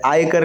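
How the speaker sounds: आयकर said as "aaykar", long aa; correct long vowel. Shown as a demonstration. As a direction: open आयकर on a full "aay". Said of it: "लिखा जाएगा".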